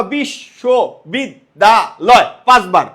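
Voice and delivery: বিশ্ববিদ্যালয় stretched slowly; drawn out. Shown as a demonstration. Teacher slowly spelling out the word's sounds. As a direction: pull বিশ্ববিদ্যালয় apart slowly. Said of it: "এটা কিন্তু ওর মূলত এইরকম না".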